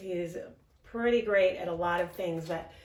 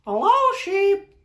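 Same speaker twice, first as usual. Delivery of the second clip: high, louder squeaky duck; 13 semitones higher, 8 dB louder than usual. Acted. Reading to Ruben, Duck greets Sheep, squeaky high. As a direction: pipe up like a chirpy duck. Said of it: "we have to use the voices".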